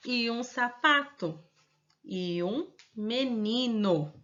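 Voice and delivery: put-on slow, careful model reading for children; slow reading aloud. Shown as a demonstration. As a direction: read it slowly so the kids can follow. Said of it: "vamos fazer a leitura". (leitura lenta e pausada para as crianças)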